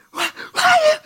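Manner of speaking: very high, loud mock shriek; imitated freak-out. Woman swatting at the air, imitated freak-out. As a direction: shriek it like someone losing control. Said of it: "she freaked out".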